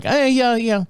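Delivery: mock awkward agreement. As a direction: play along awkwardly. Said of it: "And I'm like".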